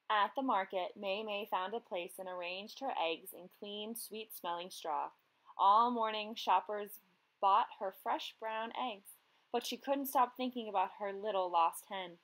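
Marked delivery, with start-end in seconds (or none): none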